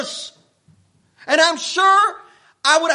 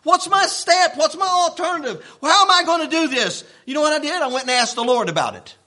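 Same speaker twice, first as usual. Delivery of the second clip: ordinary talk, nothing marked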